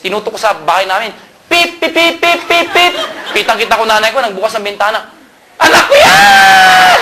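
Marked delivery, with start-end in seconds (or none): 1.5-2.9 high beeping horn sound; imitated car horn. Son honking outside his mother's house, high horn imitation. 5.6-7.0 high, slow, drawn-out joyful yell; mother shouting. Retold or imitated. Mother spotting her son's car, high drawn-out shout. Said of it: "sigaw ng sigaw nanay".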